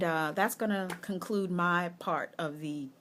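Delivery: normal talking voice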